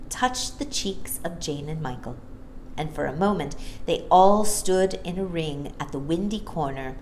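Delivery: plain reading voice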